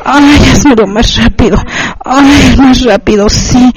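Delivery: urgent cries, little added volume; understated crying out; mild acted cry. A narrator's reading. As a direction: call out urgently, held back. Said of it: "hace que ella grite".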